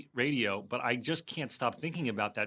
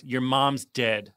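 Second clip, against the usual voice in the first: slow deadpan line; 3 dB louder, much slower than usual. Retold or imitated. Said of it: "And she goes".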